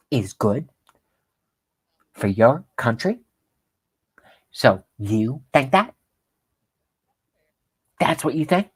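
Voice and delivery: a lightly voiced, smug anchor lecturing; preachy put-down with a sly rise; mocking Rachel Maddow. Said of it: "I'm making up her words".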